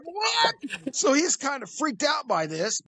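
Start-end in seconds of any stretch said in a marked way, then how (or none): 0.0-0.9 high squeaked what; a squeaky, disbelieving what; mock shock. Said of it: "I was like".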